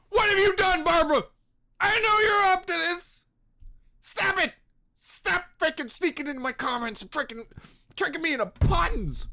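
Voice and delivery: high, loud hammed-up outrage; mock rant. Yelling at Barbara for sneaking puns into comments. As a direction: yell at Barbara in fake fury.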